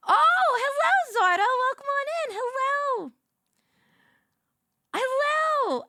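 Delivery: high, singsong hostess voice welcoming guests; high diner host greeting. Pretend. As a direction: greet him like a cheery waitress at the door.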